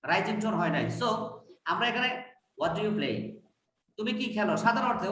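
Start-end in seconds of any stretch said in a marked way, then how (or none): 2.6-3.2 "what do you play?" pitched low, falling at the end; low falling tune. Shown as a demonstration. Teacher shows the class the lower tune. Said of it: "lower tune আসছে".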